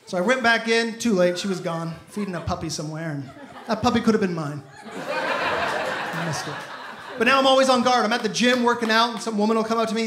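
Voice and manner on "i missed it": slow lament. Real, not acted. Callback to the missed chance.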